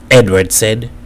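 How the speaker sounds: ordinary delivery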